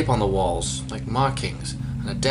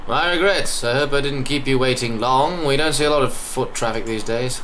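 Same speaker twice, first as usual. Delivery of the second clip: loud, higher English host voice, sounds like smiling; English accent; 3 semitones higher, 4 dB louder than usual. Acted. Greeting rare visitors on foot, English accent. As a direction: play a patient, courteous English greeter. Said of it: "I don't know why he's English".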